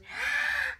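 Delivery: sharp high gasp of delight; excited gasp. Acted. George hearing he has a surprise, delighted gasp. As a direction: suck in air with glee.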